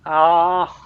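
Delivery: ordinary talk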